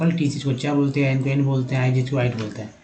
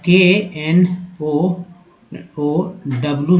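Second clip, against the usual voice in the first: केएनओओडब्ल्यू spelled out very slowly; slow spelling; much slower than usual. Shown as a demonstration. Teacher slowly spells केएनओओडब्ल्यू for the students. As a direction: draw every letter out. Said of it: "में जो है नो पढ़ते हैं".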